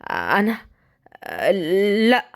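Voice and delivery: tense, halting answer; nervous. Acted. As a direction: stall before denying it. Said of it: "رد عليه بتوتر".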